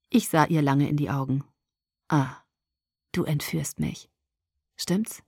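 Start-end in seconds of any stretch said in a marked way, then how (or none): 2.1-5.2 dry, suspicious. A narrator's reading. Bella durchschaut Alice, leise und misstrauisch. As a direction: lower and softer, knowing accusation. Bella realises she is kidnapped.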